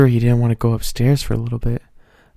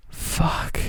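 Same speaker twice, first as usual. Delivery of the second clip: breathy, hushed curse; whispered swear; 6 semitones higher, 6 dB quieter than usual. Real, not acted. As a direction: gasp the word out under breath. Reacting to the steamy line.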